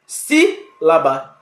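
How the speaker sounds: "Sílaba" drawn out slowly, syllable by syllable, stressing SÍ. Shown as a demonstration. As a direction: sound out "Sílaba" slowly, weight on the first syllable. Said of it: "Qual é a antepenúltima?". ("Sílaba" bem devagar, sílaba por sílaba, forte no SÍ)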